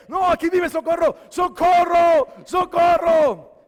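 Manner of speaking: defiant high shout, drawn out on the name; screaming for help. Retold or imitated. Boy refuses to hush and calls neighbour, desperate scream. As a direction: keep yelling for the neighbour, full voice. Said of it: "empecé a gritar".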